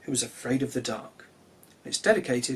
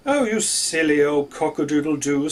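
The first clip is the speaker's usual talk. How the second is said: louder, fond teasing voice; teasing sun; 6 dB louder than usual. A narrator's reading. The sun teases the rooster, fond and loud. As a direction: tease him gently as the sun. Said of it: "said the sun".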